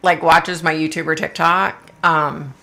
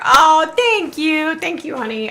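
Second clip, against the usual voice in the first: delighted thanks; 8 semitones higher, 3 dB louder, slower than usual. Real, not acted. Streamer thanks a viewer for a hair compliment, delighted. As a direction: start high and loud, then soften.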